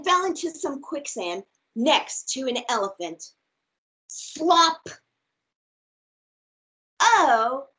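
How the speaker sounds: lively, expressive storybook read; reading to children. Acted. Teacher reads a picture book aloud to her class, bright and expressive. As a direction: read it big and playful for little listeners.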